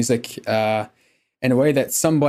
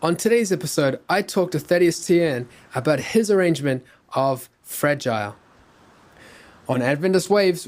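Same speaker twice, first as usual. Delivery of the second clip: bright, raised show-opening voice; podcast intro read; 6 semitones higher, 3 dB louder than usual. Acted. Host opening the episode for listeners, upbeat announcer read.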